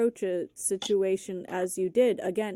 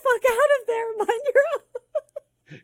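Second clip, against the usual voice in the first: much higher, louder, laughing mock tough guy; mock local tough guy; 8 dB louder than usual. Host voicing locals telling the lost guest to leave, laughing mock tough. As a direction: ham up a street tough shooing him off.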